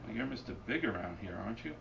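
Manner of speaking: normal talking voice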